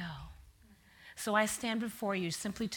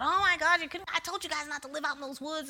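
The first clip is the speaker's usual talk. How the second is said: alarmed sister-in-law; 7 semitones higher than usual. Retold or imitated.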